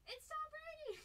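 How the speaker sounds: retold fan squeal; starstruck squeal. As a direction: squeal at spotting a celebrity. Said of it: "I was like".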